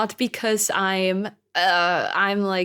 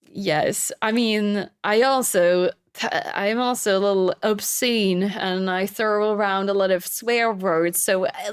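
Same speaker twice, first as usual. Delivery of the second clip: normal talking voice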